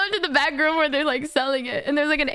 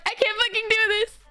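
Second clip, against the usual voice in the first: high, whiny, rambling near-cry; tearful panic; 7 semitones higher than usual. Retold or imitated. Panicking customer explains the birthday gift to the clerk, tearful. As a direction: plead your innocence, imitating someone tearing up. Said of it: "I start tearing up".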